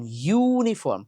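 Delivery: ইউনিফর্ম slowly, with a held "yuu" opening; pronunciation demo. Shown as a demonstration. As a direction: lean hard and long on the "you" starting ইউনিফর্ম. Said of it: "ইউ, ওয়া উচ্চারণ যখন হবে". (ইউনিফর্ম-এর "ইউ" জোর দিয়ে টেনে বলা)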